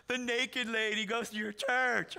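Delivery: slow, scandalised disbelief; mock incredulity. Pastors ask about the town drunk at church, mock incredulity. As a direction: draw it out, astonished.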